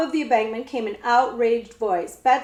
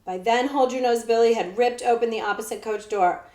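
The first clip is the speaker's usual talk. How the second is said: usual speaking voice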